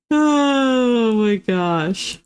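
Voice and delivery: very slow, half-crying laugh; laughing despair. Real, not acted. Streamer reacting to chat's joke, laughing with a teary break. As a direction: stretch it out as if laughing through tears.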